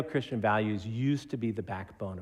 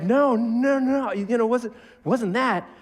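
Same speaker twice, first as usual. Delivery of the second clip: high, loud panicked denial; flustered denial; 11 semitones higher, 6 dB louder, slower than usual. Retold or imitated. Husband caught out, denying in a high panicked voice. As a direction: squeak out a nervous denial. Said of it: "he's like".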